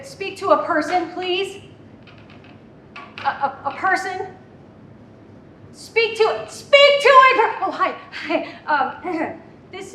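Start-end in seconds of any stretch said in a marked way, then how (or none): 0.0-7.6 rising, louder, exasperated plea; pleading to the menu. Acted. Mom begging the phone menu for a real person, getting louder. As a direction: insist on a human, louder each time.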